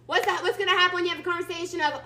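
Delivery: normal talking voice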